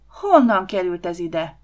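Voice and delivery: loud indignant demand; outraged outburst. Acted. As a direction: burst out in outraged disbelief. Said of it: "hördült fel Csaba".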